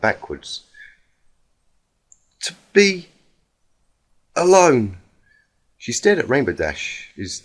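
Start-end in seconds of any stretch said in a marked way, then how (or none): 2.4-5.0 despairing plea. Acted. Rainbow Dash, distraught.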